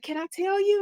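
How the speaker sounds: high, sing-song eager voice; lightly voiced eager older lady; eager mom. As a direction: mom leaning in to spill it.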